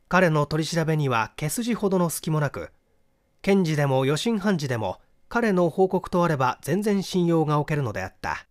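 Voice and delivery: ordinary delivery, nothing marked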